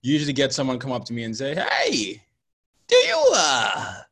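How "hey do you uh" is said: high, loud put-on voice, drawn out slowly; mock clueless asker voice. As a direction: ham up the awkward person booking you for February. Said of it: "I love that voice".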